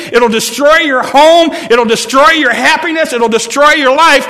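high, loud, pleading alarm; urgent warning cry. Real, not acted. Pastor warning the church about snake talk, loud urgent cry. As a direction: wave the warning flag with your voice. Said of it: "I want to wave it, and I want to say".

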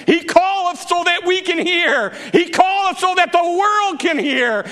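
high, driving preacher's cry; fervent proclamation. Real, not acted. Preacher telling how the Master calls, high and fervent. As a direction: proclaim it so the world hears.

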